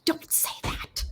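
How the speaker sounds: hushed frantic plea; urgent whisper. Acted. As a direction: whisper it sharply.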